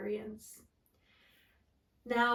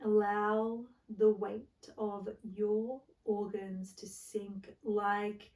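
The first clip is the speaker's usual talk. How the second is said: guided meditation. Acted. Yoga teacher settling listeners into the floor, guided meditation.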